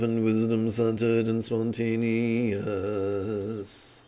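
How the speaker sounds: loud, steady, slow meditation voice; guided visualization. Acted. Teacher guiding students' visualization, steady loud meditation voice. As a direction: guide them into the image, evenly.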